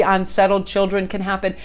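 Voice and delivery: ordinary talk, nothing marked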